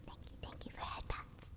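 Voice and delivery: soft unvoiced whisper; whispered thanks. Real, not acted.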